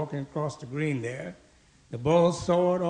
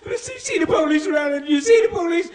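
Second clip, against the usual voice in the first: loud, high-pitched frantic cry; tearful, breathless old lady; 12 semitones higher, 7 dB louder than usual. Acted. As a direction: play a panicked old lady begging for police. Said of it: "rushed to me with tears in her eyes, all out of breath".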